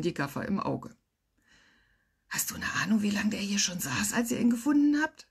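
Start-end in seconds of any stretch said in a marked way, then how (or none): 2.3-5.3 hushed whispered question; breathy, secretive whisper. A narrator's reading. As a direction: whisper it so only colleagues hear. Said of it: "wisperte ich".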